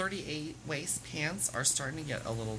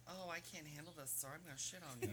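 much softer, almost under her breath; quoting herself; 18 dB quieter than usual. Retold or imitated. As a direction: hush it as a joking aside. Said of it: "And I'm like".

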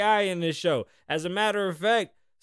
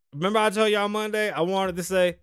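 ordinary delivery

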